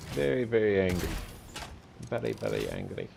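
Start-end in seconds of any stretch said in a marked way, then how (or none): 2.1-3.1 much lower, mock-grave repeat; deep mock voice. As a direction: drop the voice low to tease. Teasing the angry enemy.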